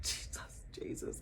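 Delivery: breathy whisper, Jesus twice; hushed plea to Jesus; whispered prayer. Retold or imitated. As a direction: pray under your breath in panic. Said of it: "I was like".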